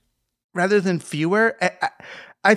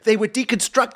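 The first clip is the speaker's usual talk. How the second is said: normal talking voice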